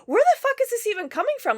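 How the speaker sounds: high, fast, exasperated question; real exasperation; incredulous rant. Real, not acted. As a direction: fire it off in disbelief. Ranting about the lore.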